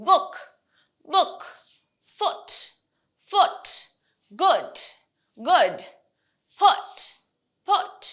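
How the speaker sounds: BOOK, FOOT, GOOD, PUT with a short oo; quick lax oo in book, foot, good, put; short vowel. Shown as a demonstration. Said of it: "This is not a".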